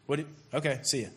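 low, offhand mumble to a buddy; bored bro phone voice. Retold or imitated. A guy brushes off his friend on the phone, low and offhand. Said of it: "when they talk to their guy friends".

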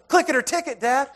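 put-on high-pitched kid telling Dad off; imitated scolding daughter. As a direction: chide Dad in a high child's voice.

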